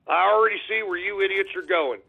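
usual speaking voice

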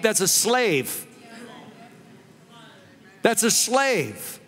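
slow, weighty stress on "slave"; "that's a slave" drawn out, with "slave" stressed; slow emphasis. Acted. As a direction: let "slave" land hard and slowly. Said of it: "sermon emphasis".

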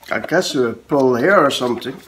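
ordinary delivery

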